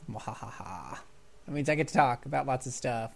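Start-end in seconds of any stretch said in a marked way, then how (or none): 0.1-1.0 quiet breathy villain cackle; mock evil cackle under breath; evil laugh. Villain laugh after co-host leaves.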